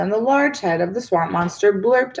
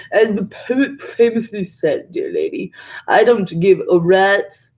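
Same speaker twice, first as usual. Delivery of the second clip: pompous swamp monster. A narrator's reading. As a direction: play the mock poet, then the rude end. Monster quotes a poet mockingly.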